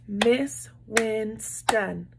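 slow, every syllable split apart; syllable clapping. Shown as a demonstration. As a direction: clap out each syllable of Winston slowly. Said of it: "how many times did I clap".

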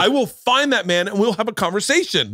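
high-pitched imitation of a fired-up dad; mock outraged buddy. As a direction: rant high, like a buddy itching for a confrontation. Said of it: "my buddy starts going".

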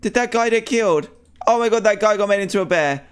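high-pitched, aghast exclaiming; shocked alarm. Real, not acted. Player sees the guy's grim fate, shocked alarm. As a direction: cry out high in real shock.

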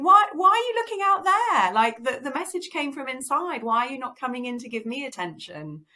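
high, loud protest, easing toward the end; indignant body. Retold or imitated. Body scolding us for looking outside, indignant protest. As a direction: play the body demanding to be noticed. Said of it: "And our body's there going".

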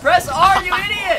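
yelling in frustration; angry shout. Real, not acted. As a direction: scream at a teammate. Urging teammate in game.

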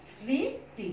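স্মৃতি said as সৃঁতি, the ম silent, the first sound nasal. Shown as a demonstration. Teacher demonstrating স্মৃতি with a nasal সৃঁ. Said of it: "দেখো আনুনাসিক উচ্চারণ করেছি".

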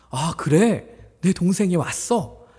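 high, loud excited greeting; delighted welcome. Acted. Imagined brother's joyful welcome. (반갑게 높고 큰 소리로)

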